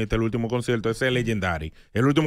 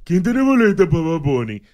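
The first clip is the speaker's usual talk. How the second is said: louder imitation of ticket hunters; mock eager plea; 3 semitones higher, 6 dB louder than usual. Host mimics people asking online for tickets, mock eager plea. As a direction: call it out like a desperate fan, mockingly.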